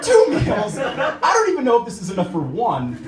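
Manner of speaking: outraged he ate too little; mock outrage at the two meals remark; indignant disbelief. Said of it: "And I was like".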